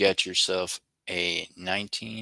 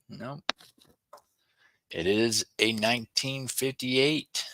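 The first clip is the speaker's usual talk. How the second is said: ordinary talk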